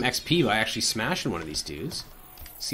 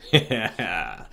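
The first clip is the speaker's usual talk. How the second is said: slow sinister chuckle; a dark spirit laughing low; evil low chuckle; 6 semitones lower than usual. Pretend. Shadow creature laughs.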